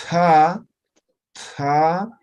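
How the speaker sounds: "Ta" loud with a breathy puff; aspirated ta. Shown as a demonstration. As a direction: push air out after "Ta". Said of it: "eh, aspirada". ("ta" aspirada, soplando)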